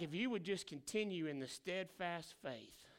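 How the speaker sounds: low, soft and calm; God speaking softly, reassuring; God's gentle voice. Retold or imitated. God gently reassuring his people, low and soft.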